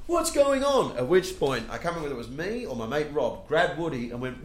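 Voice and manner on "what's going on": very high, loud mock bewilderment; bewildered outcry. Clueless friend after seeing the punch, bewildered high cry.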